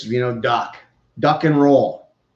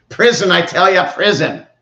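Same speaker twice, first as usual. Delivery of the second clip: mock outraged shout; 5 semitones higher, 7 dB louder than usual. Mock outrage over a hit.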